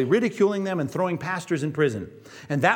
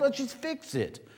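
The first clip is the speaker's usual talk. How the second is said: gentle coaxing; 8 semitones higher, 7 dB quieter, slower than usual. Retold or imitated. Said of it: "gentle urging".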